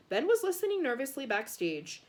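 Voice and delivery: ordinary delivery, nothing marked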